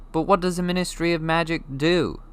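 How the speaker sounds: put-on curious boy voice. Harry asks.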